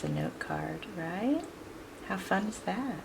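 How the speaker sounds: soft, murmured aside. Real, not acted. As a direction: say it softly to yourself as you work. Quiet aside while working.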